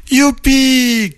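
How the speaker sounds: high, drawn-out whoop of joy; gleeful cheer. A narrator's reading. Bear cubs escaping to play in puddles, gleeful. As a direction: cheer like an overjoyed child.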